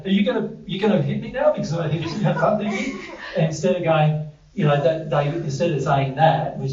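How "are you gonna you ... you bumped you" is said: higher, quick, nervous pleading; mock timid fear. Person who bumped a stranger in a shop, asking in a quick, high, mildly mock-fearful voice. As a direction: play the frightened bumper begging not to be hit. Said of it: "the polite thing to say".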